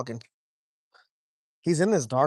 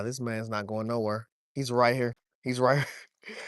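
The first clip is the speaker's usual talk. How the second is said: ordinary talk, nothing marked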